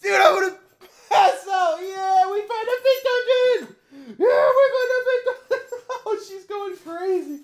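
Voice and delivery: very high squeal with laughter. Real, not acted. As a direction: squeal the words, giddy to the point of tears. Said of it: "I literally screamed like a little girl".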